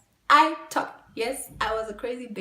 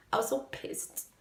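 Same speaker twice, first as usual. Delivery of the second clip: whispered aside; 4 semitones higher, 5 dB quieter than usual. Real, not acted. Repeat of the phrase.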